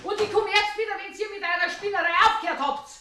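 Frau Schmidt leaving the two men in a rage; furious exit. Acted.

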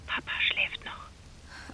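hushed whisper so the father sleeps on. Acted. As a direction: whisper down the phone, careful not to wake anyone. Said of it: "Wieso sprichst du so leise".

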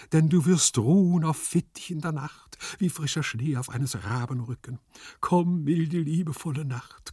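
high theatrical declamation with swelling calls; declaiming like Krälinger. A narrator's reading. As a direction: summon the night like a stage Juliet. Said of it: "ich deklamiere ganz wie die Krälinger". (pathetisch deklamiert wie auf der Bühne)

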